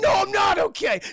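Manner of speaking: high, loud manic yell; unhinged shout. Retold or imitated. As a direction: shout it like you've lost it. Mel losing his mind.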